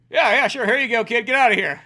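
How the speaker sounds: loud, high, brisk waving-off; hammed-up offhand, indulgent bus man; bus driver. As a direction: play a driver shooing kids along. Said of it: "do you think the bus driver".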